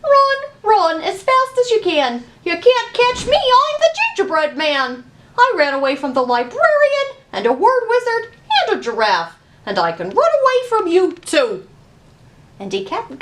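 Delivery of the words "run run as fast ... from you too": much higher, loud, quick boastful chant; cocky gingerbread man. A narrator's reading. Gingerbread man taunts everyone chasing him, high and cocky. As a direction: tease the chasers, bouncing and bragging.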